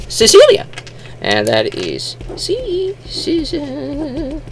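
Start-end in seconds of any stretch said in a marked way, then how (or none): 0.1-0.7 very high, loud "Cecilia"; high-pitched name reveal. Shown as a demonstration. Shows the third character's name. 2.3-4.4 high, soft, stretched "Cece"; drawn-out syllables. Shown as a demonstration. Slowly writing out the name.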